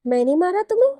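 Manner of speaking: can't believe what she just heard; shocked disbelief. A narrator's reading. Wife stunned at husband's accusation, high disbelieving question.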